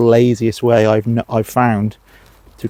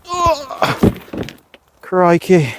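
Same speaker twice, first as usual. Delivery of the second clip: sudden high startled yelp; 7 semitones higher, much slower than usual. Real, not acted. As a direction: caught off guard, jumping. Startled by a sunflower.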